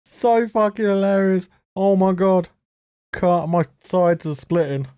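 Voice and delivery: high mock delight still laughing; fake-praise the lame joke; cut, my sides are splitting, dripping with sarcasm; sarcastic amusement. Real, not acted.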